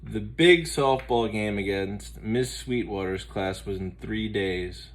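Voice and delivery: usual speaking voice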